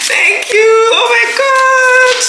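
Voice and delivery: high, drawn-out squeal of gratitude; overwhelmed thanks. Real, not acted. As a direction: squeal it slowly, overcome by the gift. Overwhelmed by gifts.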